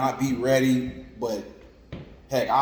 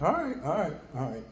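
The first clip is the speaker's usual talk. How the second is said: high-pitched reluctant all rights; a lightly voiced, high sigh of giving in; 6 semitones higher, 3 dB quieter, faster than usual.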